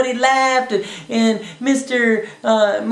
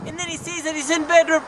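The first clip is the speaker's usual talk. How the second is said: plain reading voice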